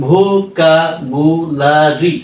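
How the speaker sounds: Vocabulary stretched slowly, syllables joined; slow blended word. Shown as a demonstration. Tutor blends the drilled syllables for learners, slowly. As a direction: draw vocabulary out slowly with a rise at the end. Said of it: "হ্যাঁ, ঠিক এভাবেই".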